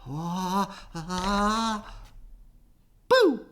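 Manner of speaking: high, loud boo; little mouse scaring the elephant, loud; mouse shouting boo. A narrator's reading. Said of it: "shouted a little gray mouse".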